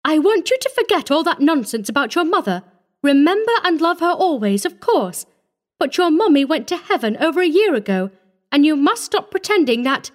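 high-pitched, insistent telling-off; stern scolding. A narrator's reading. She commanded shortly.

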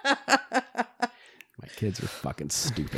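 slow laughing words. Real, not acted. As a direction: say it while cracking up.

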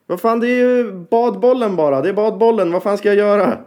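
high-pitched exasperated cry; frustrated at the frozen program, voice jumps up high; exasperated outburst. Real, not acted. Musician cursing the spinning beach ball, high and exasperated. Computer freezes during recording.

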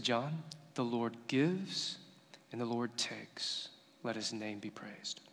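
very soft, slow, solemn words of faith; retold hushed acceptance. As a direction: let each phrase fall quietly, with grieving reverence. Grieving sister's reply, retold.

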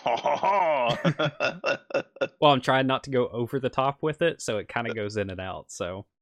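put-on light on-and-off French accent as the villain; a French scientist, accent slipping in and out. GM as French ex-colleague on the phone, light French accent. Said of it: "excuse my terrible French accent".

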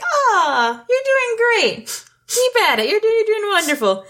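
mock-sweet geese cheering him on; encouraging goose voice. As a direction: coo encouragement at a drowning cat. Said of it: "and they're like".

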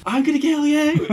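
high, strained pretend menace; mock threat. Player trash-talking an opponent before the game, mock menace. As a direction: threaten them in a comic high voice.

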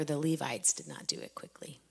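a hushed whispered aside; whispering. Real, not acted. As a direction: drop to a whisper to make the point. Quiet aside after reading scripture.

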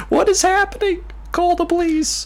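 high squeaky mock alarm; mock panic. Mock alarm after laughing fit.

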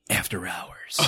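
hushed spooky whisper. Pretend. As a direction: breathe it like a secret. Spooky joke about hardware store.

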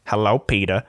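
lightly voiced Cleveland; a hint of a mellow cartoon greeting. Quoting the Family Guy scene, a hint of Cleveland. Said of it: "Cleveland goes".

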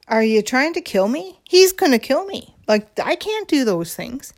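rising, squeaky disbelief; half-joking alarm, high on kill me; mock panic. Her panicked retort quoted.

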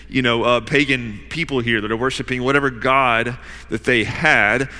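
usual speaking voice

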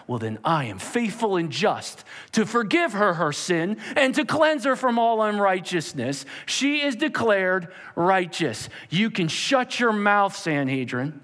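high, loud preaching; fiery preaching. Acted. Preacher describing the courtroom to congregation, fiery and loud.

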